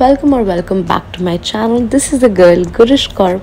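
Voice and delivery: loud, bright channel welcome; excited greeting. Real, not acted. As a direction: greet your viewers with big energy.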